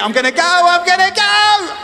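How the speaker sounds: high frantic inner voice; panicked. Retold or imitated. Man racing to fix a forgotten card, frantic panic. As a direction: rush it in a blind panic.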